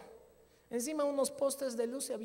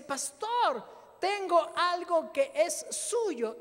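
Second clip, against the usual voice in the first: louder, slow mysterious stranger; 7 semitones higher, 7 dB louder, much slower than usual. Retold or imitated. Thief returns money.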